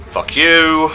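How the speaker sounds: taunting with a high, loud fuck you; gleeful taunt. Pretend. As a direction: spit it at the enemy with glee. Gleeful insults at killed demons.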